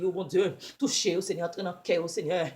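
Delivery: normal talking voice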